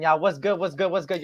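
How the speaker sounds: normal talking voice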